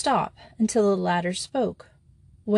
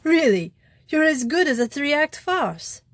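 delighted yell; 6 semitones higher than usual. A narrator's reading. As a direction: crow with delight at the fool. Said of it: "Flambeau yelled with delight".

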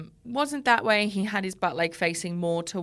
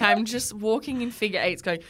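normal talking voice

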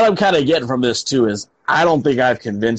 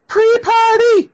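hype announcer; 5 dB louder, slower than usual. Acted. Hyped show name.

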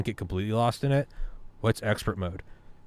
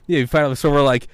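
ordinary talk, nothing marked

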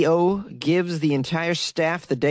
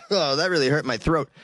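ordinary delivery

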